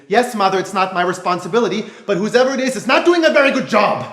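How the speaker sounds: rising into a loud laughing shout. Acted. Talking back to his preachy mother, loud shout. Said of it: "Great, now I'm shouting".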